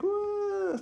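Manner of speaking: very high imitation puppy wail; high-pitched mock howl; mock dog whine. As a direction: wail like a puppy left alone. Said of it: "she starts whining and howling".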